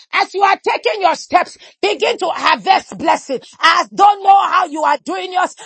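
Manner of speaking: ordinary talk, nothing marked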